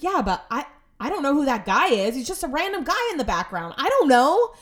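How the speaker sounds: exasperated protest, rising to a shout; frustrated. Retold or imitated. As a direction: build to a fed-up outburst. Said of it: "he wonders why I get frustrated".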